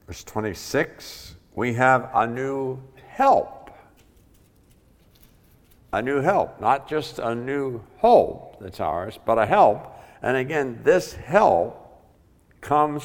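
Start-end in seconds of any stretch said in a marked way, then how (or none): 1.5-13.0 loud, slow preaching build; full-force preaching. Acted. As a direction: preach it out to the congregation.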